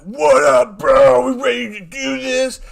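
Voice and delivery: loud, hyped mock greeting; exaggerated excited bro shout; imitating pumped-up coach. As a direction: burst in like an over-eager coach. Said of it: "he sees Kyler. He's like".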